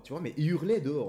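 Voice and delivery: ordinary delivery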